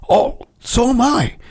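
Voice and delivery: mock friendly recruit voice, drawn out; imitating Butch. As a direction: slip into the new guy's eager voice for a beat. Butch's voice in the retold scene.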